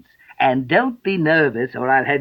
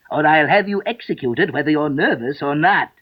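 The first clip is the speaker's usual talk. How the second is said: higher-pitched royal threat; angry King; 4 semitones higher than usual. Acted. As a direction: threaten the witness with stern displeasure. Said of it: "The king repeated angrily".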